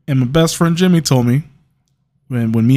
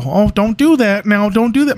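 mocking parents; 7 semitones higher than usual. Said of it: "I like how parents go".

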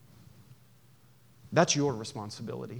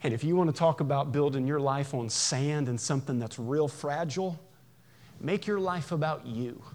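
ordinary delivery

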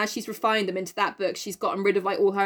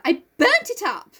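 high gleeful confession; 11 semitones higher than usual. Retold or imitated.

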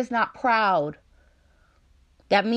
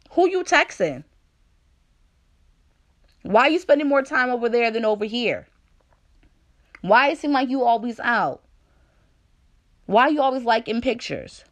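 put-on jealous girlfriend; 4 semitones higher than usual. Acting jealous partner's questions.

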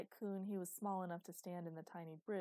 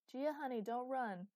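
raised, higher parental call; calling after someone; 6 semitones higher, 7 dB louder than usual. A narrator's reading. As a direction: call out to a child running off. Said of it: "Ten called after his daughter".

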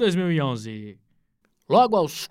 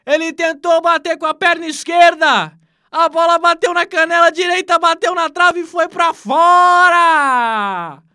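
higher, louder shouted disbelief; excited miss commentary; 10 semitones higher, 10 dB louder than usual. Acted. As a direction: wail at the missed open goal. Shouted call of a missed chance.

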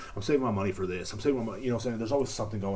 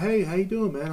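friendly church guy; 11 semitones higher, 5 dB louder than usual. Retold or imitated.

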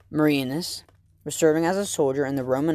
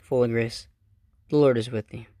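prayer recitation; 3 semitones lower than usual. Acted. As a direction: pray it aloud, reverently. Recites the Hail Mary.

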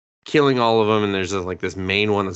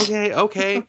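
higher, hurried backing-down okays; caving in, hammed-up; mock placating; 12 semitones higher than usual. Doctor gives in to wife's insult, mock placating.

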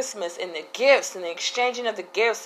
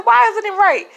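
higher and louder, puzzled; surprised question; 11 semitones higher, 7 dB louder than usual. Retold or imitated. Mother retelling her puzzled reply to her son, high and loud. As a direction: ask it back, caught off guard. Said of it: "I said".

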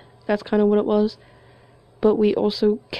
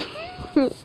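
a high, squeaky embarrassed laugh; cringing laugh; 6 dB quieter than usual. Real, not acted. Recalling her bad episodes, squeaky embarrassed laugh.

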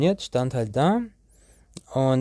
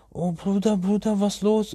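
alarmed friend; 8 semitones higher than usual. Retold or imitated.

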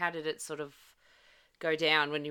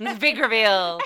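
louder, higher playful fanfare; mock-dramatic announcement; 4 semitones higher, 8 dB louder than usual. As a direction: trumpet it like a big unveiling. Teasing echo of announced reveal.

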